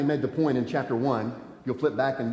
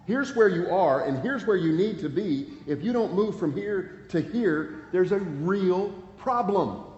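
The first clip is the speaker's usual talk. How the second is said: pleading warning; 6 semitones higher than usual. Retold or imitated. Voicing the warning to sinners.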